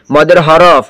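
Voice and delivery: normal talking voice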